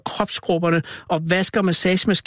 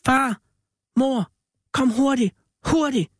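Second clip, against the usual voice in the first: much higher urgent cries for help; children shouting; 10 semitones higher than usual. A narrator's reading. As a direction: call the parents in panic. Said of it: "hørte de børnene råbe".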